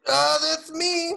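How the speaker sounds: talking while stretching. Real, not acted. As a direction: voice strained mid-stretch. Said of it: "Sorry, I'm stretching".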